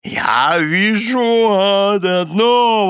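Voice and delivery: high, loud, long-drawn proclamation; exclaiming. A narrator's reading. Husband passing judgement on his wife, loud exclamation. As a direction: proclaim it slowly, vowels stretched. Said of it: "воскликнул мистер Корнер".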